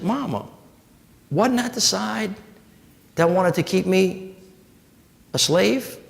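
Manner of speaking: put-on a child slowly realizing; lightly voiced small daughter, hushed; little girl. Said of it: "And she said".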